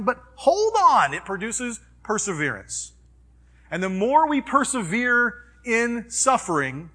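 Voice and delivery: rally them to hold on; fervent preaching. Acted. Pastor urging the congregation to hold on, loud and fervent.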